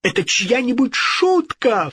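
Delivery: high-pitched outcry; indignant cry. A narrator's reading. King realising he was tricked, crying out. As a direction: exclaim it high as it dawns. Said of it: "вскричал он".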